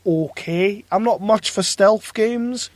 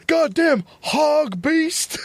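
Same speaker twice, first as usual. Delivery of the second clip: high, drawn-out Keanu voice; exaggerated Keanu Reeves impression; 10 semitones higher, much slower than usual. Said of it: "they do Keanu Reeves".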